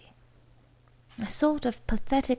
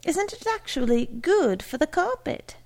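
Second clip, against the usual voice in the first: put-on high, girlish question; inquiring daughter; 7 semitones higher than usual. A narrator's reading. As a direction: ask it high, as the hopeful daughter. Daughter earnestly asks the salesman.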